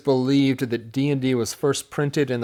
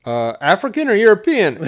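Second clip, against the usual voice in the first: high, loud comic counter-question; imitated film character, amused; quizzical knight; 8 semitones higher, 6 dB louder than usual. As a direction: ask it high, on the edge of laughter. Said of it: "And the guy goes".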